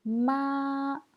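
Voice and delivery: "ma" held long, high and level; first tone. Shown as a demonstration. Teacher reviewing the four tones, "ma" high and level. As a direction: sustain "ma" on one high pitch. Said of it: "Premier ton".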